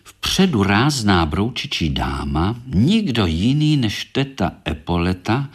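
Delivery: ordinary delivery, nothing marked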